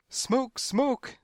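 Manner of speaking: high, loud alarm cry; yelling. A narrator's reading. Crowd scrambles out hollering in the hall, yelling. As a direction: yell the warning high. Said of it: "they yelled".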